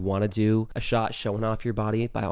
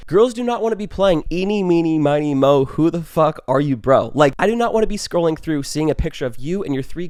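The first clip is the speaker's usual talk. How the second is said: loud, exasperated rant; 5 semitones higher, 6 dB louder than usual. Real, not acted. Ranting to viewers about group profile pictures, loud and exasperated. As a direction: let it explode, fed up with group photos. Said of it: "Sorry for that rant".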